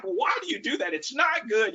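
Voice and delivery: high, half-joking scolding call; teasing scold. Retold or imitated. Coach teasing a player the kids laugh at, mock scolding. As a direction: roast him while hiding real frustration. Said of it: "hiding part of my frustration".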